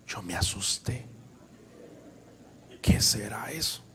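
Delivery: soft, unvoiced scared whisper; frightened whisper. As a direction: whisper it like a boy who just got spooked. Scared boy hears strange voice.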